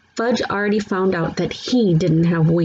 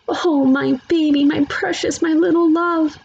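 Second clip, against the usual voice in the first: cooing mother; 10 semitones higher than usual. A narrator's reading. Mother cuddling hurt toddler.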